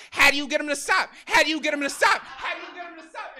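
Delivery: loud, high joke-teller voice, dragged out; hammed-up repeated setup. Stranger proudly repeating his joke to the comic. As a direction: belt the riddle like a showman.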